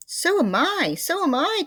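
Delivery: very high, loud frightened cry. A narrator's reading. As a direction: cry out in fear. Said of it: "cried Mister Twit".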